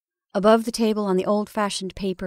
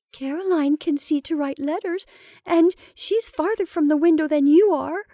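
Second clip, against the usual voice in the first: pleading protest; 8 semitones higher, 3 dB louder than usual. A narrator's reading. Rebecca pleads against the lamp.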